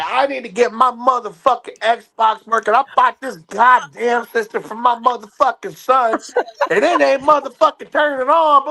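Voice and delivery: high, angry customer rant, rising to a yell; a mock-angry mother ranting down the phone; irate customer. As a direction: play the furious caller demanding her Xbox work. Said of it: "she's like".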